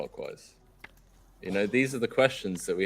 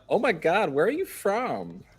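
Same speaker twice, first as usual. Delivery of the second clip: gushing American woman; 10 semitones higher than usual. Retold or imitated. Imitating American women who hear his accent, high and gushing. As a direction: gush like a starstruck American girl. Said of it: "Every time they're like".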